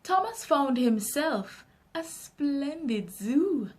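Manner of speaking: usual speaking voice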